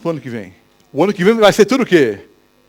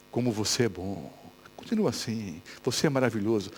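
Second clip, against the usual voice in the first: low, much softer flattering voice; tempting devil voice; 9 semitones lower, 15 dB quieter than usual. Acted. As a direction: murmur it low like a sly tempter. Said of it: "Vocês nunca ouviram essa voz?". (voz baixa e sedutora do diabo)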